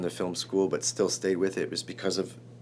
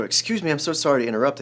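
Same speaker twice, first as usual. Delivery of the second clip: higher, louder, hurried apology; apologetic interruption; 6 semitones higher, 5 dB louder, faster than usual. Retold or imitated. Nominee butting in on John Landis, apologetic. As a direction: rush out an urgent polite apology.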